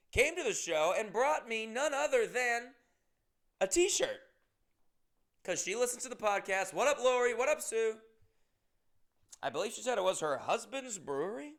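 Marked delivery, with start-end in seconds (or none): 0.1-4.2 higher, louder build to the reveal; building excitement. Real, not acted. Comedian hyping a fan's gift, rising high and loud. 6.7-8.0 higher, louder hype greeting; shout-out. Real, not acted. Podcaster giving fans an excited shout-out. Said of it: "shout-out to listeners".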